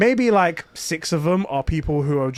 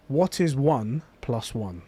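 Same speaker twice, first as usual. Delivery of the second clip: slow, low, solemn quiz question; in-character stormtrooper; 4 semitones lower, 5 dB quieter, slower than usual. Retold or imitated. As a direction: pose it slowly, like a grave test. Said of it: "the stormtrooper's all in character".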